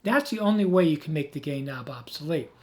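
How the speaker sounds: usual speaking voice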